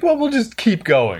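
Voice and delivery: high-pitched, blithe doctor send-up; put-on high voice of an oblivious doctor; mock unbothered doctor. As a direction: brush off the horror in a high mock voice.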